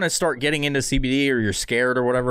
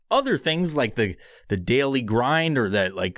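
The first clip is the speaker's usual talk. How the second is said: normal talking voice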